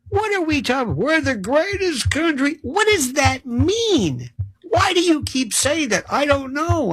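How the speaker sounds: high, whiny mock clueless patriot; high-pitched parody of a slogan repeater. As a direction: play the clueless flag-waver, pitched high. Mocking greatest-country slogan speakers.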